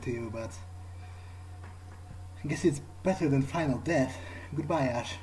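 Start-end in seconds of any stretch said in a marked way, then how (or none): none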